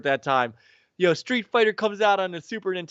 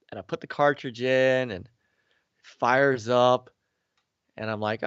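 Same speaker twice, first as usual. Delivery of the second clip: ordinary talk, nothing marked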